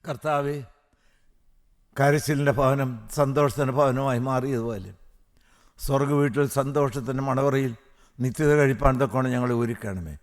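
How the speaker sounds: low, hushed prayer; closing prayer. Real, not acted. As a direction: bring it down to a soft, reverent plea. (താഴ്ന്ന സ്വരത്തിൽ പ്രാർത്ഥന)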